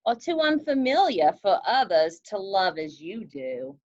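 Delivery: picture book read aloud to a class; story reading. Acted. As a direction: read it gently to the kids. Teacher reads picture book aloud.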